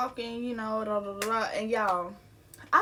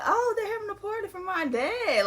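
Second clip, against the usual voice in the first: much higher, innocently thrilled; naive excitement; 10 semitones higher than usual. Retold or imitated. Teller seeing cars outside the house, naively excited. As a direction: play up your clueless delight, pitched right up. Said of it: "so naive".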